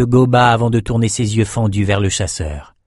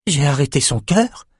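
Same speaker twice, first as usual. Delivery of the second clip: confused exclamation; 5 semitones higher than usual. Acted. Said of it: "s'écria-t-elle confuse".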